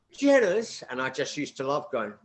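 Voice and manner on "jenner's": imitating a posh old lady, higher and louder; a haughty Edinburgh lady naming her stop. Posh Morningside ladies answering conductor.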